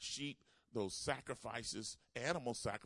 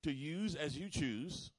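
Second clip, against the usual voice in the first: slow, loud, surrendering prayer; a preacher voicing a vow of surrender; fervent prayer; 4 semitones higher, 6 dB louder, slower than usual. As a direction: pray it out slowly with full weight. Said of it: "That means".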